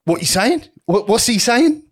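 high, put-on puzzled geezer voice; squeaky mock confusion; mimicking the intruder. Stranger confused about the golf rules, high mock voice.

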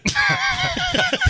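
high burst of laughter; loud laugh. Real, not acted. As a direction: crack up at your own punchline. Laughs at own joke.

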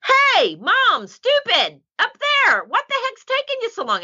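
very high, cheeky dog voice; dog scolding a slow mom, high and cheeky; dog's voice. Pretend. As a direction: squeak it out like an impatient dog. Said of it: "finally he goes".

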